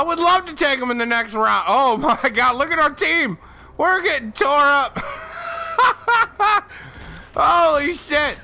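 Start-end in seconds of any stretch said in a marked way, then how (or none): 5.0-6.6 high, long cackle; real high-pitched laughing fit; cracking up. Real, not acted. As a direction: burst out laughing at the disaster. Cracks up at team's score.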